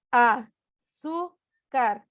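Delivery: "azúcar" very slowly, broken into a, zú, car; dictation-style slow "azúcar"; syllable by syllable. Shown as a demonstration. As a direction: sound out "azúcar" with a pause after each syllable. Splits example word into syllables.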